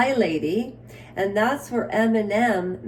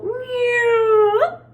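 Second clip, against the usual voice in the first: high squeaky meow voice; cat meow; 13 semitones higher, 6 dB louder than usual. Pretend.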